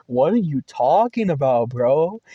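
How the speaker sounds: incredulous, rising on WHAT; baffled retort, imitating own confused reaction; baffled disbelief. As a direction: thrown and disbelieving. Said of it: "I'm just so confused".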